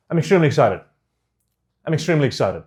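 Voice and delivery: "I'm extremely excited" quickly run into one blur; mixed together. Shown as a demonstration. Coach showing what to avoid, "I'm extremely excited" mashed together. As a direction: slur "I'm extremely excited" together without separating syllables. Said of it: "Just mix them together".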